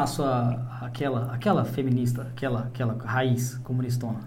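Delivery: mock the feminist with disdain. Real, not acted. Son sneers at a feminist, low and soft.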